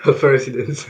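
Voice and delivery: laughing while repeating it; amused chuckle through the words. Real, not acted.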